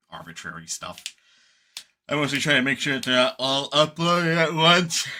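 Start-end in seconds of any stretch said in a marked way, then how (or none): 2.1-5.1 talking through a yawn. Real, not acted. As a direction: yawn while speaking. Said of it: "Excuse my yawning".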